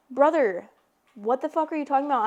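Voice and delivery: exasperated mock outrage at her boyfriend; outraged. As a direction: fire it back at him.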